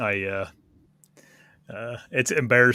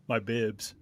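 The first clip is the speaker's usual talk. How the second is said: ordinary delivery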